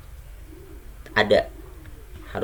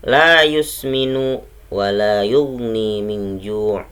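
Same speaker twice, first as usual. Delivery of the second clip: slow Quran recitation; 4 dB louder, slower than usual. Shown as a demonstration. Teacher reciting verse seven for the class, slow chant. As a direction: chant the verse slowly with long vowels. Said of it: "ayat yang ketujuh".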